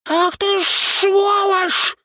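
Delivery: very high, slow, indignant creature cry; indignant outburst. A narrator's reading. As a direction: flare up like an offended imp. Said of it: "взвился Шнырька".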